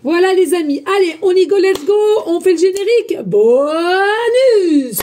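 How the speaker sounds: loud, high, pumped-up cheer; hyped show opener; excited kickoff. Real, not acted. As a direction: fire up the audience for the intro. Launching the show's opening credits.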